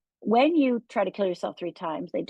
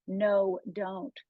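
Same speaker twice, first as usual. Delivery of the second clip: ordinary talk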